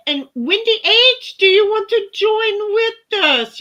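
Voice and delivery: high, loud and slow call to a friend. Real, not acted. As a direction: sing out her name across the room. Calling out to a chat member.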